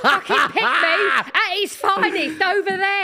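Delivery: laughing through the words; mocking while cracking up. Real, not acted. Mocking someone on screen, laughing.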